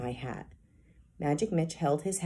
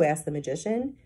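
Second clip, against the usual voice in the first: usual speaking voice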